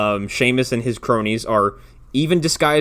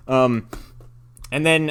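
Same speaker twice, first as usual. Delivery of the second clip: usual speaking voice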